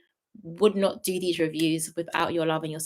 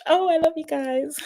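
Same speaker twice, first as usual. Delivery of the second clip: high, cooing affection; sweet high-pitched gush; gushing fondness; 9 semitones higher, slower than usual. Real, not acted. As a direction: coo it lovingly at the viewers. Affectionate sign-off to viewers.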